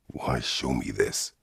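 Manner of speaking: usual speaking voice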